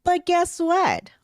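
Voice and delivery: rising outrage as she recounts; worked-up telling. Real, not acted. Building outrage telling story.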